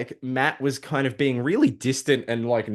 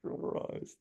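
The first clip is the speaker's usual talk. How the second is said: guilty sigh; 10 semitones higher, 4 dB louder, slower than usual. Acted. Matt's guilty groan.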